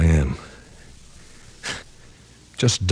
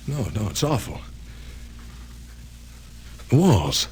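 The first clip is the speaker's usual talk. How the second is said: the walls close in, fear builds; growing fear. Acted. Reporter in the dark room, fear mounting.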